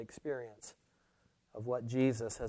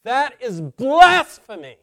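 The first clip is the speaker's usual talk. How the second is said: loud, emphatic pulpit denunciation; forceful preacher's outcry; thundering preaching; 4 semitones higher, 13 dB louder than usual. Real, not acted. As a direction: thunder it at the congregation, leaning on the key words.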